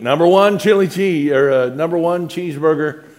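exasperated repeat into the speaker box. Retold or imitated. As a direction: say the order again loud to be heard. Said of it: "and I repeat".

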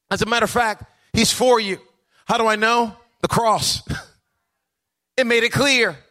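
high, loud, rising preacher's cry; climbing to a shouted climax over music; full-force preaching. Acted. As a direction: drive it home like a revival preacher.

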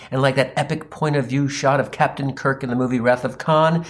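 ordinary delivery

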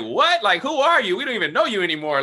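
high-pitched mock-incredulous friends; baffled disbelief. Friends react to his church plans, incredulous. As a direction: imitate pals who can't believe their ears.

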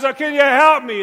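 loud high call up from a hole; hollering for help. Acted. Man in a hole hollers up to a passing doctor, loud call. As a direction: yell up from the bottom of a pit. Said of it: "He hollers".

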